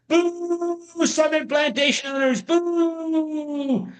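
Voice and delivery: playful high boo, pitched well up; hammed-up jeer at the villains of the lesson; teacher playfully booing the plantation owners' group; mock booing. Teacher mock-boos the next group.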